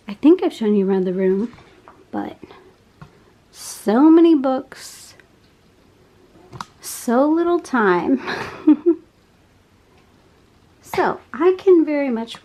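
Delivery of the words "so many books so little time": slow, drawn-out title read with weight on many; a slow, grand announcement; slow dramatic reading. Pretend. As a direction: savour each word of so many books. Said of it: "rereads the title slowly".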